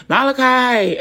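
high, loud calling-out yell. Retold or imitated. Aunt calling someone in the basement, yelling. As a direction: holler the name down to the basement. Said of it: "and I'll yell. I'm like".

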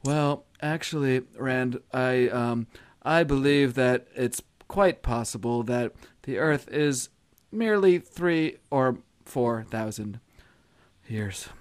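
slow, soft, drowsy drawl; slow sleepy Ben Carson. Retold or imitated. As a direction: lull them to sleep with each word. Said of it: "actually, it would be a lot slower".

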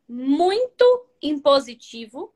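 loud, slow, heavily pushed words; overly imposing tone. Shown as a demonstration. Coach shows students a tone of voice to avoid, too imposing. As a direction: lean hard on each word, domineering. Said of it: "nenhum tom de voz".

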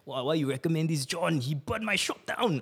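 mock complaining customer; angry client. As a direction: whine it like an upset shopkeeper.

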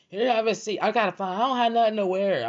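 high, loud fretting imitation; mock frantic woman. Woman hunting for clothes on a messy floor, mock frantic. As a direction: whine it high and loud, mimicking her.

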